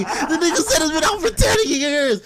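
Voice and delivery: high, laughing disbelief; incredulous laughing. Real, not acted. Storyteller mocking a coworker's false boast, laughing incredulously. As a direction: crack up through the words, pitch climbing.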